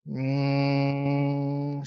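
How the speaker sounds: long steady humming bee breath; bee breath hum. Shown as a demonstration. Yoga teacher leading the class in bee breath, steady hum. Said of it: "Bhramari practice".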